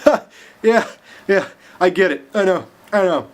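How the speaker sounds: high, loud, giggling through the words; cracking up while admitting defeat; laughing. Acted. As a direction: laugh out the answer, barely holding it in. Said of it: "just laughing".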